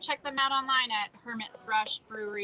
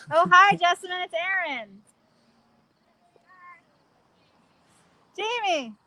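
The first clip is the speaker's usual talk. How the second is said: shouting down from up high; calling down from tower; 5 semitones higher, 7 dB louder than usual. Real, not acted. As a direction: call down to people far below. Calling down from a height.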